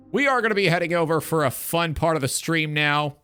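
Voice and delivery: ordinary talk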